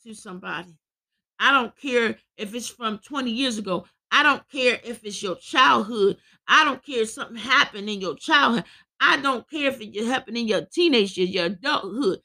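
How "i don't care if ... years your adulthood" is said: ordinary talk, nothing marked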